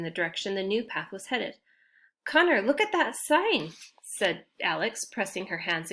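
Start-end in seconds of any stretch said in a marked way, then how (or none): 2.2-3.7 excited gasp. Acted. Alex excited at the sign.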